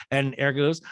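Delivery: ordinary talk, nothing marked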